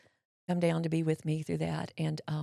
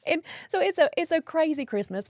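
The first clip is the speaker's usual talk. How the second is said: laughing; 7 semitones higher, 7 dB louder than usual. Real, not acted. Laughing at own story.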